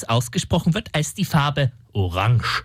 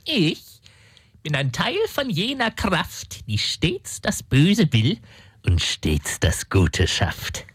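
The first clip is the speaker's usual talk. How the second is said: ominous demon quote; slower than usual. Acted. Demon quotes Mephisto grandly.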